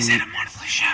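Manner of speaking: hushed whisper aside; whispered question. Real, not acted. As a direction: whisper the question across to a co-host. Hushed aside, co-host whispers back.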